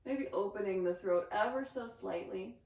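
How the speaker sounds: ordinary talk, nothing marked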